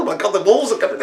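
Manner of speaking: high-pitched comic alarm; mock panic. Cave humans spotting wolves coming, mock panic.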